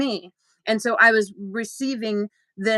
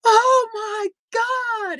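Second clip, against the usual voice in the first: high, loud cry breaking through the words; retold bawling; 13 semitones higher, 5 dB louder, much slower than usual. Friend retelling how Ricky collapsed sobbing, high loud wail. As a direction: wail it out like you've just broken down. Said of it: "he dropped to his knees and started bawling".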